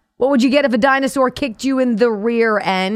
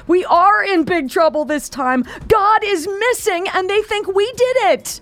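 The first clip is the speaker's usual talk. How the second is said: put-on higher, breathless little boy; panicked boy; 11 semitones higher than usual. A narrator's reading. Younger brother panicking to his older brother, high and breathless. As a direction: blurt it out, frightened. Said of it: "gasping for breath".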